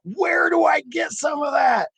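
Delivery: high-pitched, drawn-out mock yell; yelling right into someone's ear; imitated shout over music. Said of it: "gets as close as he can to my ear".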